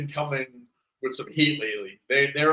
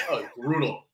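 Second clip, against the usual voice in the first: usual speaking voice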